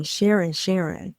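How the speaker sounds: normal talking voice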